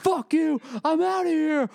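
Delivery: hammed-up screaming rant; imitating a scream. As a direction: scream it like a furious friend storming off. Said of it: "like, screaming".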